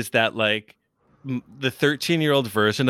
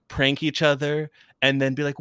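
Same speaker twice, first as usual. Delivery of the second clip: ordinary delivery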